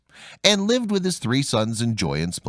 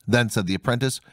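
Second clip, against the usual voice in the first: ordinary delivery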